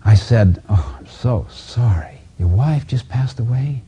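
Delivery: usual speaking voice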